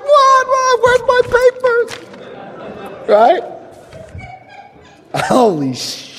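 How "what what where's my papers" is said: very high panicked squeal; comic panic. Retold or imitated. Man facing an angry father, high comic panic. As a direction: squeak it out in hammed-up alarm.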